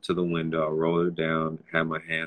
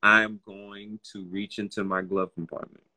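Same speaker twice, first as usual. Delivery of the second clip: measured, word by word, with pauses; careful announcing; 8 dB louder than usual. Retold or imitated. Driver telling a police officer each move, careful and deliberate. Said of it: "You had to probably vocally say that".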